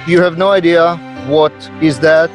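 ordinary delivery

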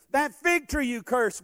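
ordinary talk

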